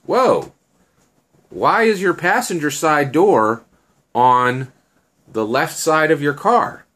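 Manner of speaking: high, loud "whoa" of bafflement; astonished question. Acted. Annie discovers the car's passenger door on the left, astonished. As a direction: ask it loud and high, pausing in disbelief.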